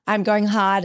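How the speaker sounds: normal talking voice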